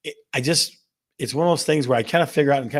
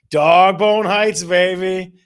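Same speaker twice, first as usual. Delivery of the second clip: slow, stretched, much louder and higher call-out; over-the-top radio hype; excited hype; 5 semitones higher, 8 dB louder, much slower than usual. Real, not acted. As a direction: belt the town name like a sports cheer. Hyping a caller's hometown.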